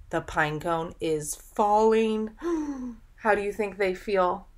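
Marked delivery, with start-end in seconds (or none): 2.4-2.9 sharp gasp. Acted. Reacts to falling cone.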